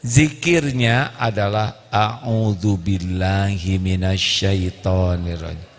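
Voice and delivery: low, slow recited Arabic; slow recitation. Shown as a demonstration. Preacher showing the correct dhikr, slow and low. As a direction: recite it slowly and reverently. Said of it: "Salah, Bu".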